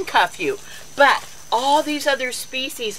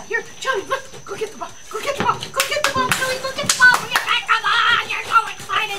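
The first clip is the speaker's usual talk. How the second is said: high, eager fetch calls; excited pet talk; 12 semitones higher, faster than usual. Real, not acted. Owner urging her dog to fetch, high and excited. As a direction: rev the dog up for the ball.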